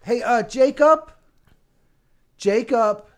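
high, slow teasing call. Acted. Calling out to kid jokingly.

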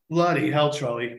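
usual speaking voice

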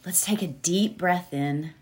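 soft, calm meditation voice; guided meditation. Acted. Healer guiding her audience into meditation, calm and soft. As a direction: ease listeners gently into stillness.

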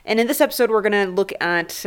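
ordinary talk

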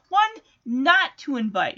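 ordinary delivery

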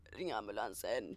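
low, slow, faint breathless plea. Retold or imitated. Friend who can't breathe begging for an ambulance, breathless. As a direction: imitate gasping for help, weak and low. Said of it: "för hon kunde inte andas".

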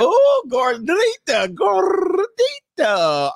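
high, very slow stretched gordita; drawn-out sing-song. Real, not acted. Host teasing the woman in the clip, high and slow.

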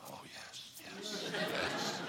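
imitating an urgent whispered cheer from the sidelines; whispered agreement. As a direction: whisper along as if prompting someone on the phone. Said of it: "she's going".

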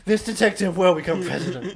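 loud, fired-up campaign speech; rally the crowd, all fired up; rousing speech. Pretend.

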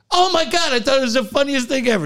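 high, fast gushing; imitated amused kid. Kid loving the swimsuit joke, imitated amusement. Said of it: "He's all laughing".

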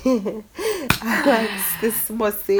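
very slow, savoring delivery; playfully drawn out. Pretend. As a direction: stretch out "I like this sausage" suggestively. Jokey drawn-out repeat after laughing.

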